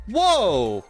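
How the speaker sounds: loud, high-pitched whoa, falling away; surprised reaction. Real, not acted. Streamer reacting to a character demo, surprised. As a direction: exclaim it in sudden amazement.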